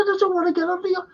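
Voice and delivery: a high, incredulous squeal of alarm; mock panicked squeak. Actor retelling the director's horse request, high squeaky protest.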